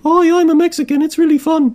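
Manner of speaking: very high, silly put-on Mexican; mock Mexican. Confirming the fun in a joke accent ad, mock Mexican in high voice. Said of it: "Here's a Mexican".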